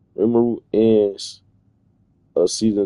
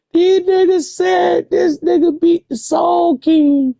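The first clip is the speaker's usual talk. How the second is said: much higher, slow, stretched-out disbelief; incredulous squeal; 3 dB louder, slower than usual. Real, not acted. Reacting to Rimuru beating the Soul King, high incredulous squeal. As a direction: squeal it like you can't believe it.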